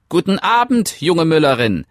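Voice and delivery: squeaky little creature, friendly hello; friendly little man. Acted. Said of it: "grüßte es freundlich". (hohe, freundliche Männleinstimme)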